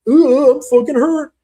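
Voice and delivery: badly faked hurt, high and loud; mock wounded wail, drawn out slowly; Cutelaba. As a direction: fake being hurt, hammed up. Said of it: "trying to fake that he was hurt".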